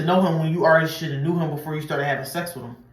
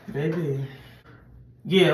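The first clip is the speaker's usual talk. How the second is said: ordinary delivery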